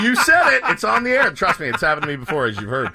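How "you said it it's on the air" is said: high, louder quoted objection; mock protest. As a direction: imitate them insisting, pitched way up. Acts out others' objection.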